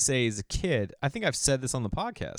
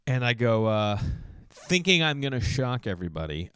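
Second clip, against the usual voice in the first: ordinary talk, nothing marked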